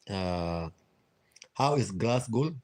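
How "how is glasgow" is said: ordinary delivery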